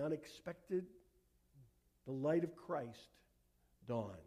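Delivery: measured sermon climax in short, weighted phrases; solemn preaching. Real, not acted. Preacher bringing congregation from cosmic dark to Christmas, solemn and deliberate.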